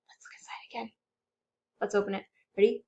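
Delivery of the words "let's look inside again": hushed, secretive whisper. Pretend. Teacher whispering to the kids before peeking again. Sneaky second peek.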